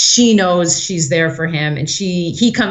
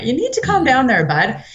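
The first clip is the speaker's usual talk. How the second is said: condescending; 8 semitones higher than usual. Retold or imitated. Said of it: "he's very condescending in nature".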